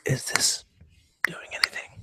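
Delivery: slow, very soft whisper; ASMR whisper. Shown as a demonstration. Podcaster testing ASMR on the audience, slow whisper. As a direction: whisper close to the mic.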